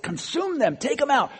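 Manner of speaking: voicing David's rage, pitched high; furious demand. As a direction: demand they be wiped out. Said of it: "And David's like".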